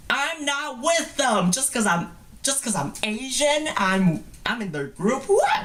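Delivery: high, slow, offended protest; hammed-up outrage rising to a high What; mock indignant. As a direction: act insulted at being lumped in. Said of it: "And so I was like".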